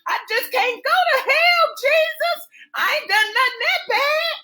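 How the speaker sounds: shrill-high, loud hammed-up terror, begging; a man imitating her frantic pleas to Jesus; mock panicked screaming. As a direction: wail for mercy like you think you're dead. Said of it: "she started screaming".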